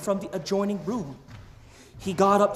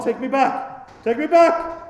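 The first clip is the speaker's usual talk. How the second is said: rising desperate plea, louder the second time; pleading fear; 7 semitones higher, 6 dB louder than usual. Acted. Scrooge begging the ghost to take him back, frightened. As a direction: beg to be taken back.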